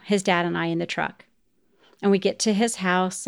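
ordinary talk, nothing marked

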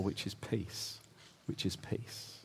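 falling to a breathy hush on peace; near whisper. Real, not acted. Preacher closing on what the soul longs for, hushed. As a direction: let it fade to almost nothing. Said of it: "repeated softly".

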